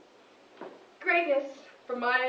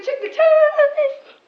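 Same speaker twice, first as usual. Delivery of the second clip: excited cry; 12 semitones higher, 13 dB louder, much slower than usual. Real, not acted. Cries out checking time.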